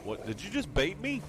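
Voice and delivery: ordinary talk, nothing marked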